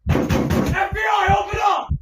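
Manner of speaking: slow, very high shouted raid call; FBI raid shout. Pretend. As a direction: bang on the door like FBI. Sound-effect style police call.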